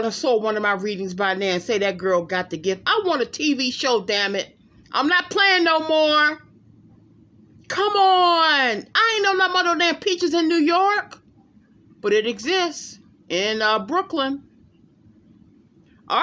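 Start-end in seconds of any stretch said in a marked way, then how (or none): 4.9-11.2 higher and louder, bursting with disbelief; excited outburst. Real, not acted. Thrilled psychic telling viewers her vision came true, loud and high. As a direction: crow it, you can't believe it.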